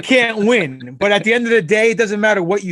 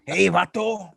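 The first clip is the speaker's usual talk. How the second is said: a lightly voiced Los Angeles street tough; Training Day gangster impression. Guest answering the host's impression with his own, lightly voiced thug. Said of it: "You sound like the fucking dude from Training Day".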